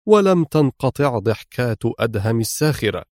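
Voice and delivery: ordinary delivery, nothing marked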